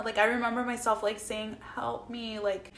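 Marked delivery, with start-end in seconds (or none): 1.7-2.4 soft, feeble retold plea; too drugged to talk. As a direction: barely get the words out, imitating grogginess. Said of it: "I couldn't even talk".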